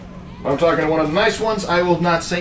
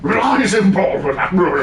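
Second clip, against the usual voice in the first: slow, louder British bluster, half-hearted; gruff British attempt; 7 semitones higher, 6 dB louder, slower than usual. Trying the British line on request. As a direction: try it like a gruff Englishman. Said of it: "There you go, try it".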